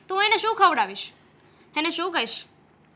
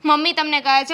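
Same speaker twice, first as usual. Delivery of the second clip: usual speaking voice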